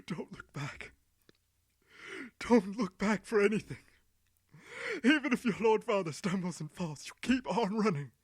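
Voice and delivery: tearful urging. Acted. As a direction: beg through tears, building. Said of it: "urging them over and over again through hot tears".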